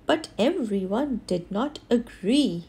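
plain reading voice

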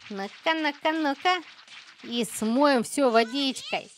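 ordinary delivery

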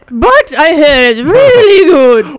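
high, loud, hammed-up regal British voice; Queen Elizabeth. Mock monarch recommends a podcast to listeners, plummy royal voice. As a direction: proclaim it as an exaggerated monarch. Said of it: "this is Queen Elizabeth".

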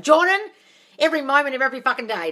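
loud, quick, exasperated answer to a viewer. Real, not acted. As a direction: fire it back as the obvious truth.

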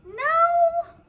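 high, loud mock wail of no. Mom reading to Harper, hammed-up loud no. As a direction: wail it like a trapped reader.

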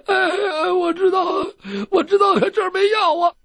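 high, loud crying answer; whimpering. Acted. Wounded doctor answering his captor, high crying whimper. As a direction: whimper through the pain.

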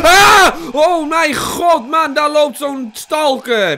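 scream, then slow loud high exclamation; terrified shouting. Real, not acted. Player suddenly spots a stalker, terrified shouting. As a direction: cry out in real shock.

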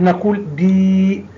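دي drawn out with a long ee; stretched dee. Shown as a demonstration. Teacher stretching دي for the pupils. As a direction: hold the ee in دي. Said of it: "عندما ننطق المد ماذا نقول".

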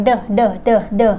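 "the" as a short repeated "duh"; D sound alone. Shown as a demonstration. Teacher isolating the final D for learners, repeated duh. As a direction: tap out "the" as a bare d sound, duh duh. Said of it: "มันก็เลยออกเสียง".

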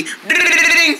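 quick high trilling dings; imitated phone ring. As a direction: ring out like a telephone bell. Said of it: "you get to ring me".

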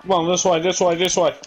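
being chased. Real, not acted. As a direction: hurry your partner along. Fleeing the monster, urging.

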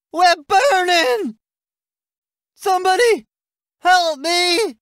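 high, drawn-out acted distress; desperate cry for help. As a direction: cry out from a burning truck. Burning vehicle, calling for help.

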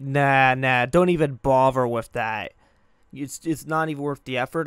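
loud, scoffing brush-off; dismissive parent. Retold or imitated. Parent discouraging a kid's hobby, dismissive. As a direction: imitate a parent waving the idea away.

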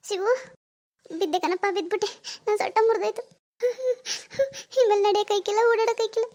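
high-pitched wailing in pain; milk the fall with theatrical sobs; hammed-up tearful lament; put-on crying. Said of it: "ಕಾಲ್ ನೋವು ಅಂತ ಅಳ್ತಿದ್ದಾರೆ".

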